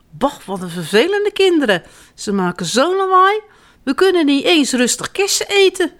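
annoyed birds. A narrator's reading. Birds fed up with noisy children, annoyed. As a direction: complain as a put-out bird.

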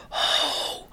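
soft, airy, voiceless call; whispering. A narrator's reading. Voiceless children failing to scare birds, whispering. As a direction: feeble whispered holler, no voice left. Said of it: "En ze fluisteren".

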